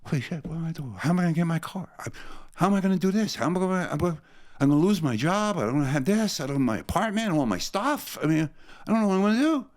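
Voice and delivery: high, racing panicked inner voice; reliving a panicked bad trip on the way to the Army; retold panic. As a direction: relive the freak-out, pitch climbing. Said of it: "I'm freaking out".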